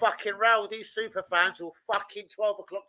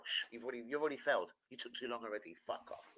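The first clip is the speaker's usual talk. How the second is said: hushed; 11 semitones lower, 18 dB quieter, faster than usual. Real, not acted. Hushed dismissal of friend.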